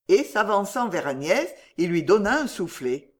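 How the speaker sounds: usual speaking voice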